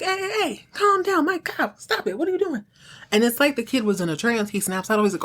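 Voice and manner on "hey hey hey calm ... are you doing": high-pitched mock alarm; alarmed dad. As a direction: imitate a panicked parent intervening. Dad stops kid with blowtorch.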